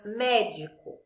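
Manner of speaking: "médico" with MÉ stressed, the rest falling away; stress on the first syllable. Shown as a demonstration. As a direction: say "médico" leaning on the opening MÉ. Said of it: "sílaba que eu falo mais alto".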